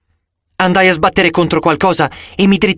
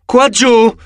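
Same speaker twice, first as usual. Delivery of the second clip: higher, loud call; yelling; 8 semitones higher, 3 dB louder than usual. A narrator's reading. Hero calls the giant down to his feet, shouting. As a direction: shout to make the giant look down. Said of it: "urlai io".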